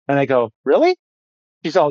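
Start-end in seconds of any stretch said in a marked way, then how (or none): 0.6-1.0 a sharp, high, louder "really?" in mock surprise; surprised. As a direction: play up the surprise, pitched high. Retold surprised reply to the wife.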